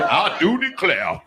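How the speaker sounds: slow, high 1800s drawl; mock Southern gentleman; slow, high parody of eighteen-hundreds speech; old-timey genteel. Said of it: "how they talked in the eighteen hundreds".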